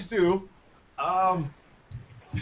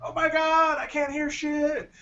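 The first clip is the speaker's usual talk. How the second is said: exasperated outburst; 8 semitones higher than usual. Real, not acted. Host complaining about broken audio.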